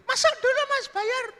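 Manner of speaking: high falsetto invitation; woman's voice. Acted. Woman inviting customer in, high falsetto.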